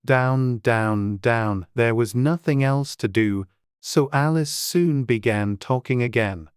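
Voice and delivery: usual speaking voice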